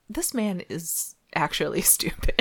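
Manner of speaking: laughing whisper of disbelief; whispered scorn. Retold or imitated. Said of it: "I was just like".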